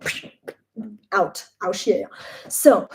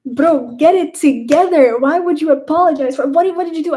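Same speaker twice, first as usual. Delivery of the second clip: raised, higher voice, telling her to get it together; friend scolding; 7 semitones higher, 8 dB louder than usual. Retold or imitated. Shiny scolding her friend, raised and higher. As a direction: snap her out of apologizing.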